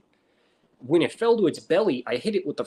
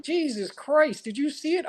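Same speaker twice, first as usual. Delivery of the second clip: amazed buddy; 12 semitones higher than usual. Retold or imitated. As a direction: gasp it out like a stunned friend. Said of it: "they're like".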